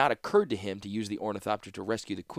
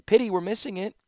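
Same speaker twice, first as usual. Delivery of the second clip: light; 12 semitones higher, 5 dB louder than usual. A narrator's reading. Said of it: "Her words were light".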